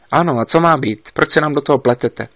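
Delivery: loud, angry yell at the meddler; doctor yelling. A narrator's reading. Doctor yelling angrily into the camera at Mark. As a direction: shout him down, fed up. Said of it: "řval doktor přímo do kamery".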